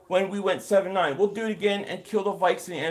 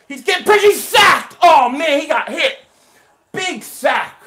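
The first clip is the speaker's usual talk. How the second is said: higher and louder, shouting the play as it happens; real rising excitement over a big hit; excited sack call; 4 semitones higher, 10 dB louder than usual. Real, not acted. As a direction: yell it like your team just got the sack.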